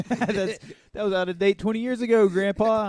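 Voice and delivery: high, loud, fast teasing jab; rapid-fire ribbing of grandpa; mock scolding grandpa. Real, not acted. Mocking grandpa, shouting.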